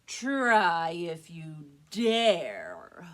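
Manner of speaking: very slow, loud goat bellow. Acted. Biggest goat bellows a dare at the troll, very slow. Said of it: "bellowed right back".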